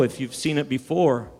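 ordinary delivery

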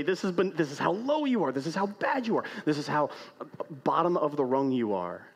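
a preacher voicing a woman belittling Bruce Lee; emphatic put-down. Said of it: "she makes an emphatic point to say".

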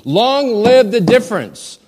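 high, loud, slow declaration; proclaimed. Acted. Preacher proclaiming the translation to the congregation, loud and slow. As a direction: proclaim it like a toast.

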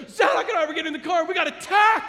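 high, loud, rushed mock panic; freaking out. Wife calling husband about birds, freaking out. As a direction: imitate a frantic wife blurting bad news. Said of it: "freaking out".

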